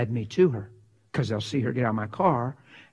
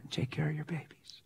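gentle whisper; 13 dB quieter than usual. Real, not acted. Gentle counsel to the woman.

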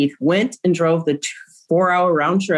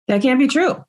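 higher, rising insistence that it is wrong; mildly incredulous, pitched up; retold disbelief; 8 semitones higher than usual. Mother retelling her reply to the detective, in disbelief.

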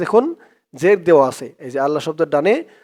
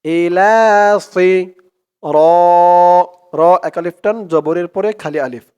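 slow, drawn-out chanted recitation; Quran recitation; 4 dB louder, slower than usual. Shown as a demonstration. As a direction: recite it slowly, stretching each vowel. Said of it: "recites the verse".

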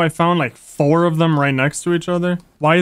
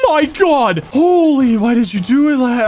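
startled; 8 semitones higher, 4 dB louder than usual. Real, not acted. Startled by a witch attack.

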